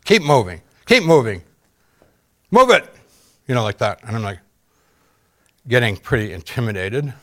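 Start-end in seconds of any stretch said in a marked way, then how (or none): 0.0-0.6 first rude order. Retold or imitated. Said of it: "become more rude". 0.8-1.4 "Keep moving" pushed louder and higher; "Keep" hit harder on the second try; harder rude order. Retold or imitated. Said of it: "become more rude". 2.5-2.9 harsh barked commands, louder and higher; rising to a shouted "Move it"; rude orders. Retold or imitated. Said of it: "become more rude".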